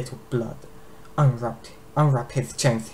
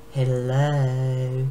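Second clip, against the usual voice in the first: a sing-song hello into the egg; lightly voiced mocking dragon calling out; calling sweetly. Dragon queen calls into the egg, mocking. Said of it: "she called".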